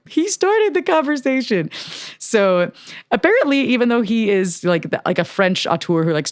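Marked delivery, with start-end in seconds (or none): 0.1-1.7 high, indignant and innocent; defensive protest. Retold or imitated. Mo protesting to the crew member, high and defensive. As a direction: insist you did nothing wrong. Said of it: "she was like".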